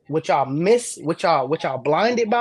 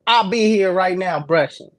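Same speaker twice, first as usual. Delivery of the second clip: higher, louder declaration; hyped loud boast; excited shout; 5 semitones higher, 6 dB louder than usual. Real, not acted. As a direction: announce it gleefully.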